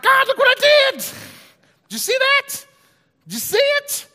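put-on proud kid yelling look what I did; excited boasting. Gideon boasts to God after toppling statue.

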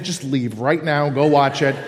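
usual speaking voice

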